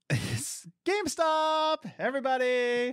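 very high, slow announcer hype; exaggerated cartoon intonation. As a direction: stretch it out like a cartoon host. Hyped announcer bit.

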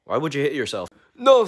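usual speaking voice

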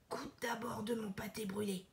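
low, soft whispered creaky stove; hushed stove voice; whispering old stove. Acted.

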